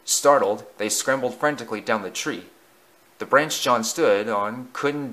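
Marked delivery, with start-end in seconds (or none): none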